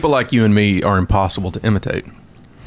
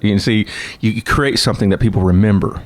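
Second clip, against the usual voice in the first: ordinary talk, nothing marked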